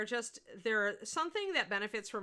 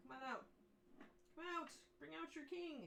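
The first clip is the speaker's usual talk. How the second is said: soft, high sing-out call; playful coaxing; 7 semitones higher, 14 dB quieter than usual. Pretend. Painter summoning the Fire Giant King miniature, playful coaxing. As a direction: coax it out quietly, pitched high.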